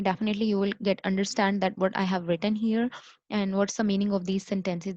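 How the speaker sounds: usual speaking voice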